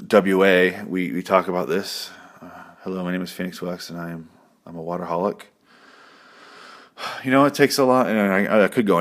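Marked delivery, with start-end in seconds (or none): 2.8-5.5 mock confession. Mock support group confession.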